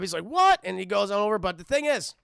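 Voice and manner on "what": high, loud startled what; Goliath hearing the museum was robbed, shocked. Retold or imitated. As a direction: snap it out in disbelief.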